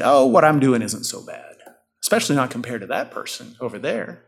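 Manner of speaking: ordinary talk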